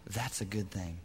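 whispered aside. Real, not acted. Quiet aside after the quote.

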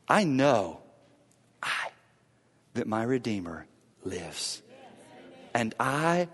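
sudden soft, low reverence; hushed conviction. Acted. Preacher quotes Job's certainty after his climax, in hushed conviction. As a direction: drop right down and leave long pauses between phrases.

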